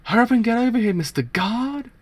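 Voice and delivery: acted urgent shout; yelling for help. Character yelling for the guard.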